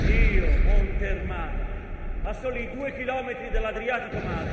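loud, slow, proud voice of the mountain; mountain speaking. Acted. The mountain introduces itself to the audience, proud booming declamation.

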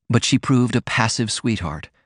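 ordinary delivery, nothing marked

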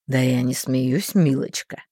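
low, slow schemer; sly man voice. A narrator's reading. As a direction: reassure her slyly, low. Thin man.

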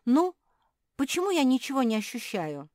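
high, loud, fretful demand; nervous impatience. A narrator's reading. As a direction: demand an answer, nerves showing. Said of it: "потом занервничала".

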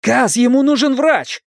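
high-pitched, desperate appeal; alarmed cry for aid; urgent plea. A narrator's reading. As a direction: beg the leader for help. Begging help for wounded friend.

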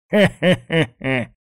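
slow, high mock-villain chuckle; drawn-out, spaced-out "heh-heh" with a grin; leering chuckle. A narrator's reading. Bald thug gloating over the cornered girl, leering chuckle. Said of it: "оскалился он жёлтыми зубами".

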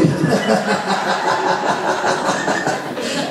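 high airy laugh without voice; real giggle at the punchline; laughing silently at the joke; breathless laugh. Real, not acted. Teller cracks up at own story.

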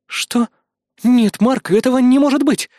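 high, loud disbelieving cry; shocked disbelief. Acted. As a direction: refuse to believe it. Disbelief at photo.

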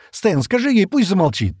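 high, loud indignant demand; angry cry. A narrator's reading. Шира appealing to Стэн to silence Джемма, angrily. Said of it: "гневно крикнула Шира".

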